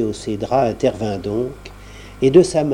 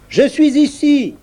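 high voice; 13 semitones higher, 5 dB louder than usual. A narrator's reading. Salive voice repeated.

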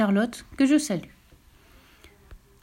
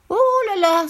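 high, loud cry of alarm; exclaiming fox; 11 semitones higher, 5 dB louder than usual. Acted. The fox sees the cow's green tongue, exclaiming loudly. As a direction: gasp it out in shock. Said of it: "s'écria le renard".

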